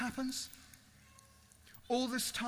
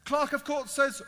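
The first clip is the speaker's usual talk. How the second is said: ordinary talk, nothing marked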